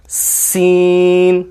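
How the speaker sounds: سین drawn out very slowly, front teeth together; a long hissing سین; slow letter demo. Shown as a demonstration. Teacher models سین for students. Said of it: "اگلے دانتوں کو ملا کر".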